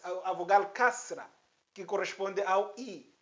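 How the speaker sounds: ordinary delivery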